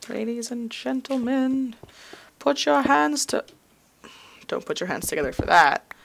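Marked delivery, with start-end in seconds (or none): 0.1-3.4 high, slow showman's introduction; mock announcer. Player introducing to an imagined audience, mock announcer. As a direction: draw it out like a hammed-up emcee.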